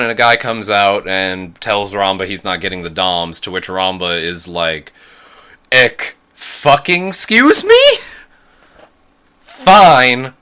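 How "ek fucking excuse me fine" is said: loud, high, indignant outburst; hammed-up defiance, stretching the final insult; Ramba's mock outrage. Host playing Ramba refused his mobile suits, hammed-up outrage.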